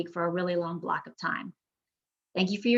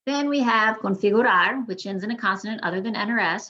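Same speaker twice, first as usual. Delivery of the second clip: ordinary delivery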